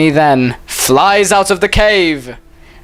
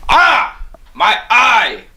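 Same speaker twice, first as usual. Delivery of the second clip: high mock yelp of pain; acted pain; 8 semitones higher, much slower than usual. Snake punched in the face, high pretend yelp. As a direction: yelp as if hit, hammed-up.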